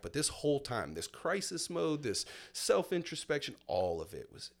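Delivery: normal talking voice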